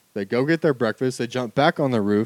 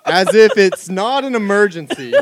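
loud, high, amused exclaiming; incredulous, laughing and raised voice; laughing mock outrage; 7 semitones higher, 9 dB louder than usual. Real, not acted. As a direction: protest loudly through laughter. Mock outrage at the calm warning.

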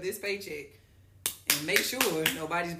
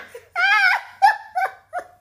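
very loud, high squeal of laughter; shrieking laugh; 16 dB louder than usual. Real, not acted. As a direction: lose it completely laughing. Cracks up telling the story.

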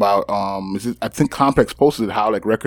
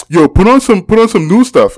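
higher, louder mock demand; pitched-up, loud retold complaint; retold older dude; 8 semitones higher, 5 dB louder than usual. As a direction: imitate a pushy guy demanding. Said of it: "this dude, older than I am, goes".

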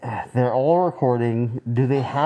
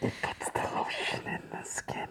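breathless killer whisper; creepy whisper; 15 dB quieter than usual. Retold or imitated. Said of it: "the guy from The Silence of the Lambs".

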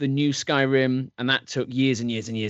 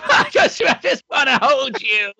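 high-pitched, loud fangirl squeal with laughter; excited fan squeal; 11 semitones higher, 5 dB louder than usual. Pretend. Squealing fan meeting Khadgar.

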